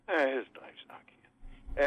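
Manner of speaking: unvoiced, slow whisper; a sly whispered aside. Real, not acted. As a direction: slip it in under your breath. Whispered aside about the caller.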